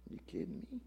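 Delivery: hushed disbelief; incredulous whisper. Real, not acted. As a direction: breathe it in disbelief.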